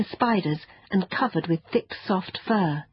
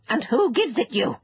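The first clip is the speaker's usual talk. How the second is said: pressing creature, pitched up; impatient; 6 semitones higher than usual. Acted. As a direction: demand an answer with mild impatience. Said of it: "the fairy said impatiently".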